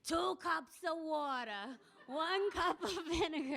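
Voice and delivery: put-on old woman rereading the recipe slow and high, grand; imperious reading again. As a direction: read it aloud slow and lofty, amused. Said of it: "started reading".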